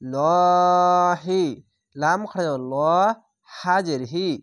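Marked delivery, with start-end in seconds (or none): 0.0-1.4 লহি sung slowly and louder with a heavy, full lah; tajweed recitation, লহি with heavy lam. Shown as a demonstration. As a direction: chant লহি long with a thick, full lah. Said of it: "এখানে লাহি কেন পড়লাম না".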